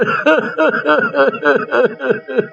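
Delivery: high, rattling chuckle; gleeful thief laugh. Acted. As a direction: laugh greedily over the loot. Thief delighted with the loot. (चोर की ऊँची, खुशी भरी हँसी)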